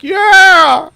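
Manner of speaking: high, loud whoop from the stands; lone crowd cheer. Acted. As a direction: shout it like one rowdy spectator. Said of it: "you hear even one like".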